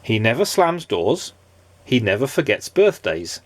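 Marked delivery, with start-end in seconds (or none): none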